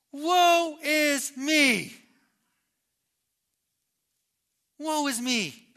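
high, loud, drawn-out lament; Isaiah's woeful cry. Acted. Preacher as Isaiah seeing his sinfulness, woeful cry. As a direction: cry out each word slowly. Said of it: "His words are".

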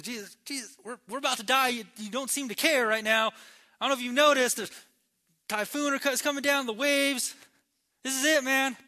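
high, mounting panic, acted; freaking out. Disciples waking Jesus in the storm, freaking out. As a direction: stumble over words, building to loud alarm. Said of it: "these guys are freaking out".

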